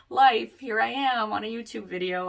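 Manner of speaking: giggly, embarrassed self-mockery; laughing it off. Real, not acted. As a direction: laugh at your own blunder while talking. Laughing at own mistake.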